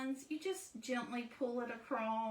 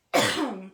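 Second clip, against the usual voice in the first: quick loud throat clear; 4 semitones lower, 14 dB louder than usual. Real, not acted. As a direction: clear the throat sharply, then apologise. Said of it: "Excuse me".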